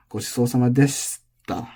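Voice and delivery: gochisousama level then falling on deshita; drop in deshita. Shown as a demonstration. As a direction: keep the accent drop in deshita. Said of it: "there's still a drop".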